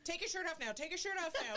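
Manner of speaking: high-pitched bossy wife; mock urgent command. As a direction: order the husband about, playfully urgent. Acting wife ordering her husband around.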